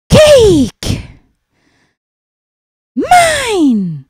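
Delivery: high, loud squawking seagull demanding its lunch; a bossy gull shrieking for its food; greedy seagull voice. Acted. Seagull grabs the cake.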